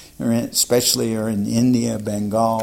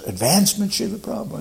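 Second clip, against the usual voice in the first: surprised question; 10 semitones higher, faster than usual. Retold or imitated. Devotees surprised, crowding closer.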